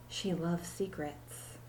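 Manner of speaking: soft, slow aside about secrets; hushed. A narrator's reading. Narrator confides Allison's love of secrets to the children, soft and slow. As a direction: lean in and say it softly.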